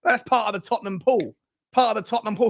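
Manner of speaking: ordinary talk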